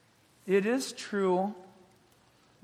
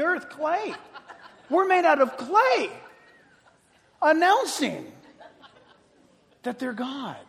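higher, louder preaching through laughter; fiery preaching; 8 semitones higher, 8 dB louder than usual. Acted. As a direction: preach it with amused disbelief.

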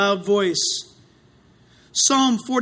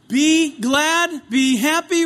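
normal talking voice

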